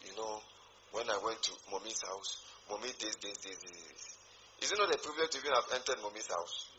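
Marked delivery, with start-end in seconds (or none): 0.1-4.0 hushed, dropping gossip voice, much softer; gossiping church member. Retold or imitated. A gossiping member quietly complaining about Mommy's house. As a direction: whisper it like tattling behind Mommy's back. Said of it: "they will go and go and say".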